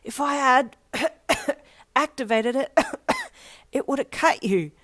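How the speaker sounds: high, strained words broken by coughs; winded, coughing. A narrator's reading. Winded friend explains after the fall, choking and coughing. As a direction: force it out between acted coughing fits. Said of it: "still trying to catch his breath".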